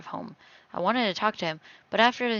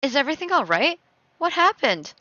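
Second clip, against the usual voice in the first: higher-pitched, anxious questions; concerned mom; 6 semitones higher, 3 dB louder than usual. Acted. Mom asks daughter what happened, concerned. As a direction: check on your child with concern.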